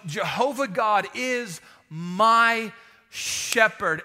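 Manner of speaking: "my" stretched out with weight before "shepherd"; slow, deliberate emphasis on "my"; stress on MY. Shown as a demonstration. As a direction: lean hard and long on "my". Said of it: "the emphasis on the word "my".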